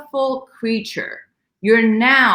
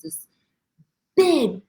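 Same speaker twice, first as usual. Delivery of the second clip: normal talking voice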